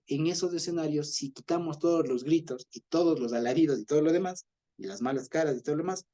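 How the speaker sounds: normal talking voice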